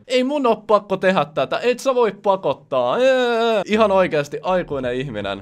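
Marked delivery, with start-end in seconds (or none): 0.1-3.0 put-on whiny high toddler voice, louder; defiant toddler. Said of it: "tulee mieleen joku uhmaikäinen lapsi". 3.0-3.6 high loud bratty "ee"; childish taunt. Retold or imitated. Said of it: "uhmaikäinen lapsi".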